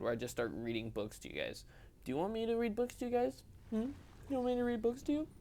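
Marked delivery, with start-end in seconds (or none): 2.0-3.9 high sing-song offer; playful teasing. Real, not acted. 4.3-5.3 high, fast sing-song; playful teasing. Real, not acted. Teasing offer repeated.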